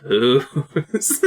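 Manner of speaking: drawn-out, rising question; amused, wondering echo. Acted. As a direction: stretch it upward with a laugh behind it. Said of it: "He's like".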